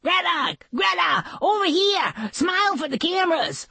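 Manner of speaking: high-pitched excited calling; yelling photographers. A narrator's reading. Photographer pups calling to the movie star, high mock yelling. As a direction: call out high and urgent for her attention. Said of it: "They started yelling".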